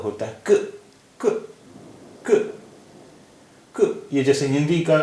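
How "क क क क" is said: क as a bare k with only a tiny release; half k. Shown as a demonstration. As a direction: repeat क as separate short halant k sounds. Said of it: "या आधा का भी जिसे कहते हैं".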